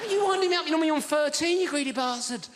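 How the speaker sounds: hammed-up indignant builder; mock outrage. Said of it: "builder's mock outrage at sweet tea".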